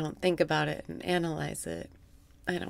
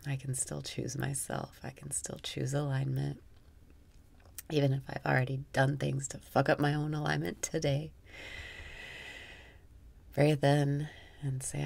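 guided meditation; 3 semitones lower, 5 dB quieter than usual. Acted. Guiding listeners through breathwork in a soft meditation voice. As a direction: guide the breath calmly, half amused.

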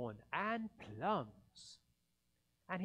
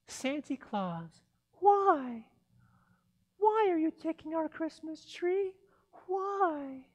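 put-on much higher small child's pleading voice; little girl; 12 semitones higher, 10 dB louder than usual. Cindy Lou asking Santa why he takes the tree, high child's voice. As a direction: plead like a tiny two-year-old, voice up high.

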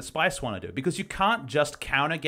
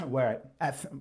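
losing voice; 4 dB quieter than usual. Real, not acted. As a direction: cough breaking into a strained word. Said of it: "whoa, I'm losing my voice".

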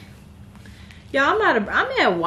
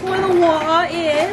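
light mock British accent, higher; 8 semitones higher, 4 dB louder than usual. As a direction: echo it in a playful British accent. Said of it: "Give your best British accent".

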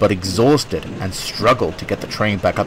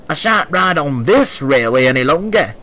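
lightly voiced grumpy old man; elderly passenger complaining; 4 semitones higher than usual. A narrator's reading. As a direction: grumble like an old gent. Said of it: "finished an elderly man".

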